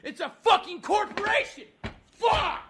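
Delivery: high-pitched, frustrated yell; exasperated outburst. Real, not acted. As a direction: let frustration boil over into a yell. Ranting furiously at support agent.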